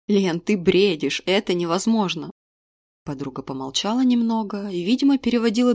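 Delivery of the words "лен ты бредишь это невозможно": plain reading voice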